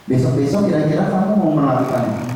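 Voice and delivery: normal talking voice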